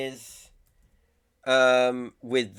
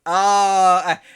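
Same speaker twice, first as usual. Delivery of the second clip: loud high emotional "ah"; a fan's helpless outburst; overwhelmed cry; 6 semitones higher, 11 dB louder than usual. Real, not acted. Catching his breath after the trailer, a loud emotional cry.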